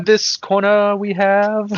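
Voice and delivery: slow, high ring announcer voice; wrestling announcer. Shown as a demonstration. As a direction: announce the fighter slowly. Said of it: "announcer voice at a wrestling match".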